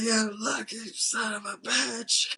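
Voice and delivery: high-pitched, drawn-out imitation scream; mock scream. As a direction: imitate an enraged movie villain screaming, slow and high. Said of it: "He's screaming".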